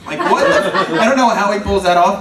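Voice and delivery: usual speaking voice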